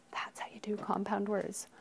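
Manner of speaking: unvoiced aside; whisper. Real, not acted. Teacher whispering to the class. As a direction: whisper it to the room. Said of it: "whispered aside".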